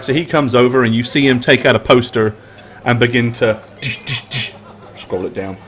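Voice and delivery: usual speaking voice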